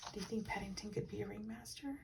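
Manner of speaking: soft, quick question. Real, not acted. Reader softly asking the child a question. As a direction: ask the little listener gently.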